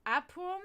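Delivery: Apum read with a rising tone as a test; sounding out Apum carefully; trying out the pronunciation. Shown as a demonstration. Sounding out a Latin word for viewers. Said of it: "So I think it's Okidi".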